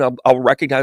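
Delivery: usual speaking voice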